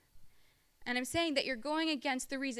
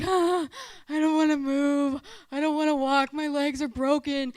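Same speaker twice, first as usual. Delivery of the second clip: put-on louder, slow whining kid voice; whiny campers; 8 dB louder than usual. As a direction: drag it out like a child who won't walk. Whining campers refusing to walk.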